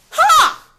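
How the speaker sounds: high, loud bark of disbelief; a single loud scoffing laugh; loud scornful ha. Real, not acted. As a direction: spit out a contemptuous ha. Scornful outburst mid-rant.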